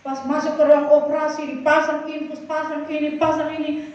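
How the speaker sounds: ordinary talk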